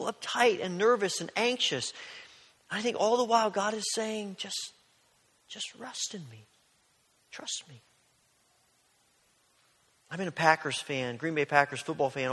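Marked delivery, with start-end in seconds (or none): 4.4-7.8 soft near-whisper, fading to a whisper; hushed, gentle. Retold or imitated. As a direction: speak it like a calming hush. God speaking gently to worried people.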